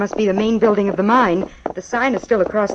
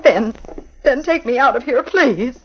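frightened plea; 6 semitones higher, slower than usual. Acted. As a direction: beg him to get you away from here. Scared wife begs to leave.

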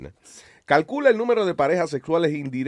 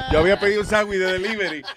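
laughing through the words; giggling at own mistake; laughing while telling; 4 semitones higher than usual. Real, not acted. Laughing at own silly story.

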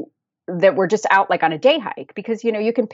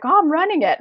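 casual runner; 11 semitones higher than usual. Retold or imitated. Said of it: "he's like".

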